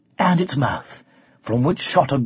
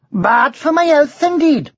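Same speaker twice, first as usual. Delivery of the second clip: high, louder Welsh dragon cry; indignant Welsh dragon; 9 semitones higher, 4 dB louder than usual. A narrator's reading. Dragon cries out at the knight, high indignant Welsh. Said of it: "cried the dragon".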